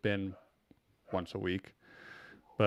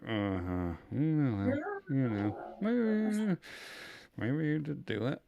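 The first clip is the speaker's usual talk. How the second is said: softer, drawn-out wavering; mock grudging doubt; 3 semitones higher, 6 dB quieter than usual. Imitating dad as the trial goes along, grudging doubt. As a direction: waver softly, reluctant to admit it.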